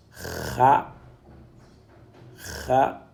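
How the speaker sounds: "Kha" with a rasped back-of-throat kh; rough throat friction on "Kha"; dotted kha sound. Shown as a demonstration. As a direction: scrape the kh at the start of "Kha". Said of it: "Quand on a le point".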